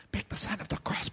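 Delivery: whispered secret tip; sharing the trick in a whisper. Real, not acted. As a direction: lean in and whisper it. Conspiratorial tip.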